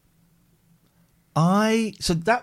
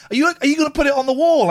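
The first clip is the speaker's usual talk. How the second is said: high, fast and louder teasing; excited teasing question; 12 semitones higher, 5 dB louder, faster than usual. Retold or imitated. Boys teasing mum about the picture, high fast teasing. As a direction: sons egging on their mum, high and quick. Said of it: "they burst into laughter and went".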